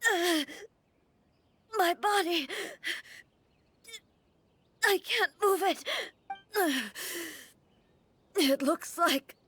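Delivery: high, strained, halting speech between gasps; struggling in pain. Acted. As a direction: force the words out through pain. Stricken, cannot move her body.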